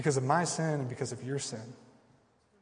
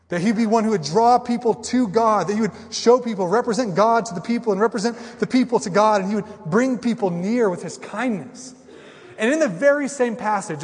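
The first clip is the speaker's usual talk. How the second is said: louder, higher preaching build; preaching at full force; 6 semitones higher, 6 dB louder than usual. Acted. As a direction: lift the promise with fervour. Preacher lifts voice on promise.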